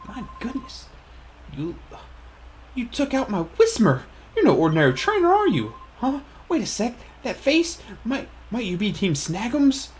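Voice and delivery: dawning recognition of a foe; startled villain. Acted. Reads the game villain's lines.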